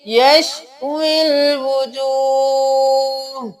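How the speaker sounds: यशविल with the wao running straight into lam, very slow; joined recitation. Shown as a demonstration. Teacher demonstrating the right recitation, slowly. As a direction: blend यशविल as one drawn-out word. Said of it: "हम वाव को लाम के साथ मिलाएंगे".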